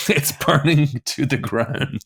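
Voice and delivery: laughing through the words, slowed. Real, not acted. Comedian laughing at his own gig disaster, slow and amused. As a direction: chuckle it out at the disaster.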